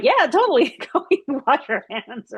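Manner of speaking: high, emphatic retold "yeah", breaking into laughter; laughing agreement. Telling the bitten neighbor to wash up, amused agreement. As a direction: agree eagerly, laugh through the advice.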